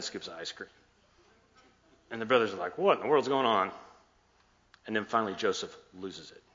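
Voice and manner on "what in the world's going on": baffled, a little raised in pitch; lightly acted astonishment; mock bewilderment. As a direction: imitate puzzled brothers asking what's happening. Bewildered brothers voiced in the story.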